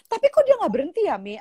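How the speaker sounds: quick, high imitated whine; complaining daughter. Daughter complains to mother he won't stop, fast high mock whine. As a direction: hurry out a child's puzzled complaint. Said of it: "dia bilang gitu".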